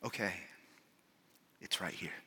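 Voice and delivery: very soft, low, hushed guiding voice; whispering friend. Acted. Friend leading blind man down the pool steps, whispering. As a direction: murmur careful directions, pausing between steps. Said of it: "hear the friend whispering to him".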